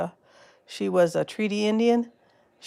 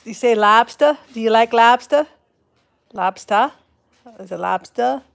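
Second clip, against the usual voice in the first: playful try at an R-less East Coast accent, questioning; R-less accent attempt; 3 semitones higher, 6 dB louder than usual. Shown as a demonstration. Vlogger tries out viewers' way of saying lobster, R-less accent. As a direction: say "Lobster" with the final R dropped, like "lobstah". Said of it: "trying not to say it with an R".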